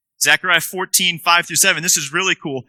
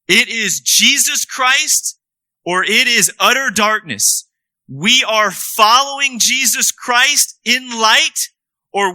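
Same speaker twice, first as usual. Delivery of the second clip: higher, slow, forceful sermon climax; impassioned preaching; 3 semitones higher, slower than usual. Acted. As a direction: drive the either-or home to the congregation.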